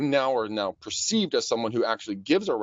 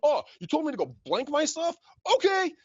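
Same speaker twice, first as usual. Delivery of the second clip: much higher, hyped-up phone voice; pumped. Shown as a demonstration. Coach demonstrating an excited unfazed caller, hyped and high. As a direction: bounce off rudeness with cheer. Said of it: "come across like you're excited".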